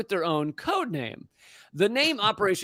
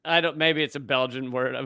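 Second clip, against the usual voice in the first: ordinary delivery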